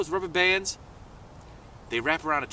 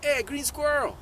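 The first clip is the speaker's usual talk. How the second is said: high-pitched call up to someone; yelling out; 11 semitones higher than usual. Acted. Toad yelling up the squirrel's tree, calling out. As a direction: call out high to get their attention. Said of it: "he yells out".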